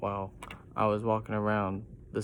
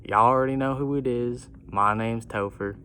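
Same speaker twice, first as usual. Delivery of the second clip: put-on country accent; 2 semitones higher, 6 dB louder than usual. A narrator's reading. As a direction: announce yourself in a brash country twang. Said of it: "in the most country accent ever heard".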